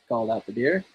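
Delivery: plain reading voice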